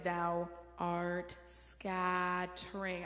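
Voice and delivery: thou art scattering stretched slowly, every consonant sharp; overpronounced, very slow. Shown as a demonstration. Choir director demonstrating diction, slow and crisp. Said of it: "I'm sounding kind of ridiculous when I speak it".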